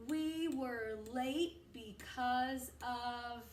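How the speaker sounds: very slow, drawn out; slow test read. Shown as a demonstration. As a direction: hold each word for the kids. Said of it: "Let's check".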